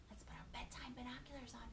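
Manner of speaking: fast hushed whisper. Real, not acted. Teacher whispering to the kids at bedtime. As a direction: whisper it like a secret game.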